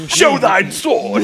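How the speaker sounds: high, slow knightly Middle English demand; slow Middle English accent. Retold or imitated. Role-player demands his sword, slow Middle English accent. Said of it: "Middle English accent".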